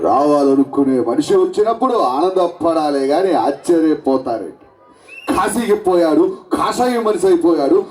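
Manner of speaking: low, slow mass-hero dialogue; Chiranjeevi impression. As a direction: deliver it like a film megastar. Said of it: "Chiranjeevi గారు voice".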